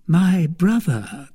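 a hint of a suspicious noblewoman; Milady. Milady probing her brother-in-law, lightly voiced.

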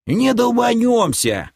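старик протяжно и высоко возражает; отмети опасения, растягивая слова; отмахивается. A narrator's reading. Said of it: "отмахнулся старче". (беспечно отмахивается высоким голосом)

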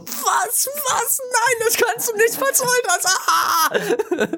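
high, disbelieving squeal; shocked outcry. Retold or imitated. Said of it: "da war ich wirklich so".

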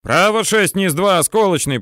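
high-pitched relayed callout; shouted repeat. A narrator's reading. Tankman passing correction down to gunner, high callout. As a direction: repeat the figures, pitched well up.

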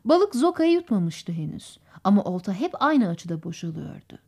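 usual speaking voice

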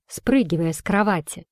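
ordinary delivery, nothing marked

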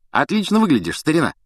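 high, gushing greeting; exaggerated hearty compliment; mock effusiveness. A narrator's reading. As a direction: gush over him in jest. Twins parody pompous greeting.

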